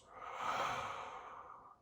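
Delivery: long, audible sigh out; exhaling sigh. Shown as a demonstration. Guide showing listeners how to exhale, long relaxing sigh. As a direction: let the whole breath fall out slowly. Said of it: "as you exhale, relax your shoulders".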